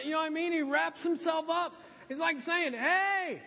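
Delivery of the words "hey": high, called-out mock warning; Billy Graham. Aged evangelist warning you not to go, high mock cry. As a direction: call it out urgently, pitched well up. Acting famous preacher's warning.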